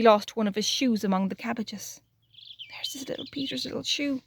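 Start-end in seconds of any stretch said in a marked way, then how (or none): 2.7-4.2 hushed aside; whisper. Real, not acted. Storyteller whispering to children about the lost shoe. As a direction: breathe it as a secret to listeners.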